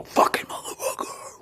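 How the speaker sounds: breathless whispered curse. Retold or imitated. Muttering alone after a year of questioning, whispered curse. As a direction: hiss the curse under your breath. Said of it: "and then you go".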